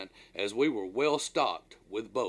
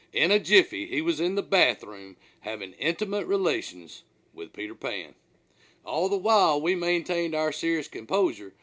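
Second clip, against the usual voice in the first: plain reading voice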